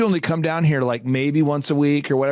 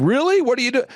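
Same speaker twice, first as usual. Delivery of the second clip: surprised; 8 semitones higher, 3 dB louder, faster than usual. Retold or imitated. Parents surprised at construction job.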